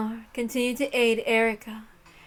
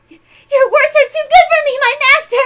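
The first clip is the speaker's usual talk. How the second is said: high, loud, choked-up gratitude; nearly crying with happiness; overwhelmed servant girl, high and loud; tearful joy; 15 dB louder than usual. Acted. Erica overwhelmed by praise.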